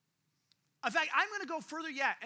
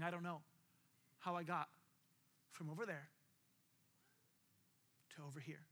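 low, hushed, halting account; retold bawling confession; 8 semitones lower, 10 dB quieter than usual. Tearful student confessing in the pastor's office, hushed retold sorrow. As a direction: retell it quietly, with long broken pauses. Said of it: "as they were bawling".

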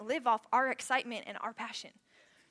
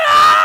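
much higher, much louder yell; wordless team roar; 26 dB louder than usual. Retold or imitated. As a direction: scream with the huddle. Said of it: "just going".

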